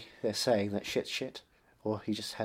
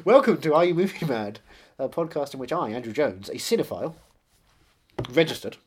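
podcast host intro; 5 semitones higher, 9 dB louder than usual. Acted.

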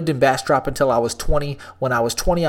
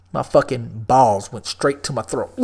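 ordinary talk

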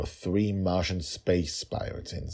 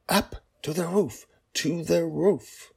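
urgent father; 12 semitones higher, 3 dB louder, slower than usual. Acted. Father repeating instruction.

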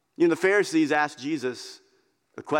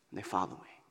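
soft echo; 8 semitones lower, 12 dB quieter, faster than usual. Shown as a demonstration. Echoing the verse softly.

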